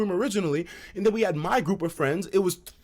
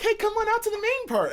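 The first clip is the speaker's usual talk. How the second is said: much higher, sing-song invitation; imitating the girls. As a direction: coax them into the room. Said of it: "the girls are like".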